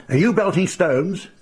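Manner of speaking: angry man. Retold or imitated. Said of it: "puce in the face with rage".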